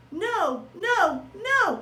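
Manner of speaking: a light high squeak. Acted. Hamster answers Mister Thomas, a light high squeak. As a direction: pipe it up like a small hamster. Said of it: "I squeaked".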